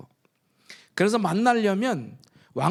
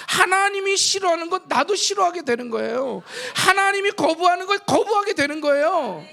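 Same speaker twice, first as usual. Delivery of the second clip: higher, louder fervent sermon build; full-force preaching; 8 semitones higher, 3 dB louder than usual. Acted. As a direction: drive the point home to the congregation. (점점 고조되는 열정적 설교)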